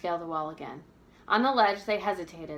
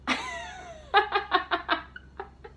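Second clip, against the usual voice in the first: high laugh. A narrator's reading. Narrator laughs in passage.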